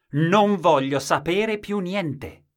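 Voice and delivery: high, slow, loud refusal; stubborn shout. Acted. Man's refusal, shouted higher.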